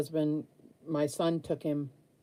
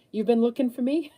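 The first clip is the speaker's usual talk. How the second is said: reading text; 7 semitones higher, 5 dB louder, faster than usual. Retold or imitated. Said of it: "I got a text message".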